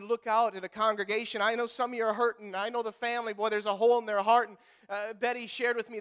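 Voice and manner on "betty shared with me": ordinary delivery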